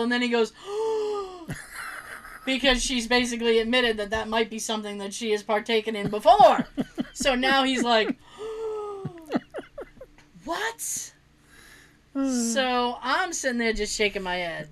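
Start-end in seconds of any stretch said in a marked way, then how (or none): 0.6-2.4 long, soft mock gasp; shocked gasp. Nephew reacts to mother's admission. 8.4-11.1 long gasp, then high, soft "What?"; imitated shock. Retelling nephew's shock at the admission.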